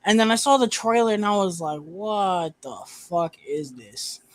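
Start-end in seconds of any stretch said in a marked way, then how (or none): 1.8-4.2 slow, drawn-out disbelief; softer, incredulous drawl; baffled reaction. Retold or imitated. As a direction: stretch the words out in mock bafflement. Retold baffled reaction to trailer.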